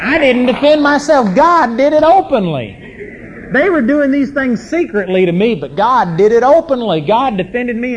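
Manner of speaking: loud, high, insistent preaching; full-force preaching. Real, not acted. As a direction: proclaim it to the whole hall.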